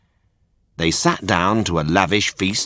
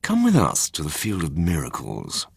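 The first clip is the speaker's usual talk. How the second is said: sly fox; 2 semitones lower, 4 dB quieter than usual. Acted. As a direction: lure him in softly like a con man. Fox tempts Pinocchio.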